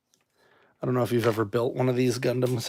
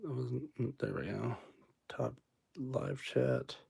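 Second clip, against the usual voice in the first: whispering; 14 dB quieter, slower than usual. Real, not acted. Muttering to self at screen.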